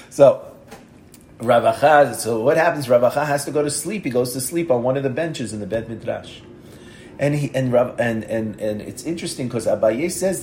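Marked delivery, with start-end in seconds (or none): none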